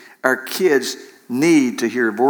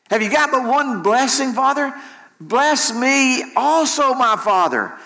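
weeping plea; 10 semitones higher than usual. Acted. Esau begs for one more blessing, acted weeping. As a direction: plead as if wailing to your father. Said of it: "lifted up his voice and wept".

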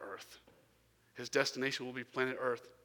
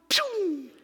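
whoosh noise; 10 dB louder than usual. Retold or imitated. Imitating daughter zooming away.

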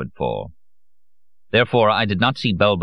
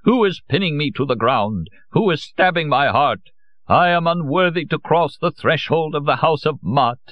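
much higher, grand rapturous recital; ecstatic declaiming; 9 semitones higher than usual. A narrator's reading. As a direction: declaim as if in a trance. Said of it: "in ecstasy ... declaiming".

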